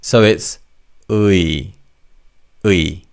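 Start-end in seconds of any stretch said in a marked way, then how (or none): 1.1-1.7 의 as 으 gliding quickly into 이; two vowels run together. Shown as a demonstration. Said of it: "say the two sounds quite quickly". 2.6-3.0 의 as 으 gliding quickly into 이; two vowels run together. Shown as a demonstration. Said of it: "say the two sounds quite quickly".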